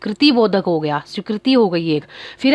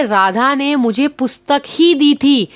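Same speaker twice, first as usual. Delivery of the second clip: पुस्तक ही said slowly and weighted; stress on पुस्तक ही; 4 semitones higher than usual. Shown as a demonstration. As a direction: lean on पुस्तक ही, slowing down. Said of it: "पुस्तक पे बल देना हो गया".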